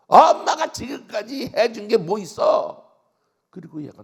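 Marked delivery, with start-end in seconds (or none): 0.1-2.9 louder, higher, slower accusation; angry yell. Retold or imitated. Daughter yelling at her mother as she storms out. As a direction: fling it back while slamming the door.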